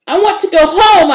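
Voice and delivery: high, loud frightened blurt; loud, high frightened outburst. A narrator's reading. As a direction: burst it out in fear. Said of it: "I blurted out".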